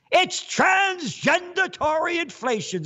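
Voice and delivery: high, put-on indignant voice; mock yelling. Fed official correcting a critic, high mock yell. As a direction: pitch it way up like a ranting official.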